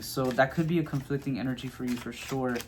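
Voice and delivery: normal talking voice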